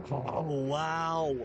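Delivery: normal talking voice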